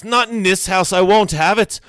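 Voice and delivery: high, anguished shouting; loud, desperate outburst; yelling. A narrator's reading. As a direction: yell it, distraught, voice high and big. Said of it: "Graham yells".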